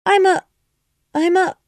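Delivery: high, loud hesitation; groping for what she is; faltering. A narrator's reading. Alice stuck for an answer, high and loud.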